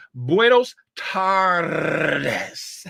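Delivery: "Buenos tardes" dragged out, R in "tardes" rolled hard. Shown as a demonstration. Showing off Spanish to Valdez, slow with a rolled R. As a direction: draw out "Buenos tardes", trilling the R. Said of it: "I rolled them R's".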